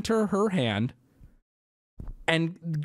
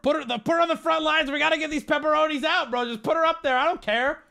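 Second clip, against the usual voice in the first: mock careless manager, higher and fast; careless boss; 9 semitones higher, faster than usual. Understaffed manager barking orders, mock careless, higher and fast. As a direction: rattle it off like a lazy boss shoving her out front. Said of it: "They're just like".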